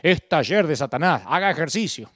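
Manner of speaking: mock pastor preaching a stock saying, laughing at the end; old pastor's warning. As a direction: pastor scolding the youth group, hammed-up. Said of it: "decía mi pastor".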